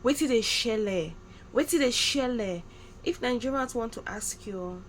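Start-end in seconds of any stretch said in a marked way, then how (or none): 0.0-2.6 a light imitation of a Nigerian Pidgin greeting; slow, casual Pidgin question, lightly put on. Imitating Nigerians asking what is going on, light Pidgin. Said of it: "they'll be like".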